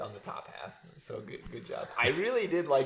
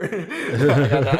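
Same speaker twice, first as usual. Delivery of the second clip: laughing; 4 semitones higher, 14 dB louder, much slower than usual. Real, not acted. Laughing after joke.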